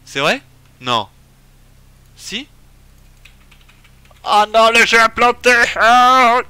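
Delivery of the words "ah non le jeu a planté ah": very loud, high wail of dismay; dismayed yell. Real, not acted. Streamer realises the game really has crashed, loud wailing yell. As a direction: yell it out, ending on a long groan.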